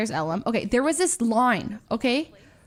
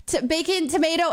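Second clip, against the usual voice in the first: rushed ordering; 6 semitones higher, slower than usual. Retold or imitated. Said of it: "and I said".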